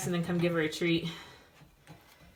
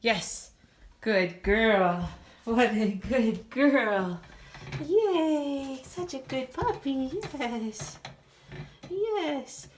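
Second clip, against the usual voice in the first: squeaky praising a dog; 6 semitones higher, much slower than usual. Real, not acted. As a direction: coo praise at the puppy. Praising puppy after quiet.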